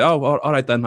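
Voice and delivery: agree, thrown and a bit flustered; caught off guard. Retold or imitated. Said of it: "And Jay was like".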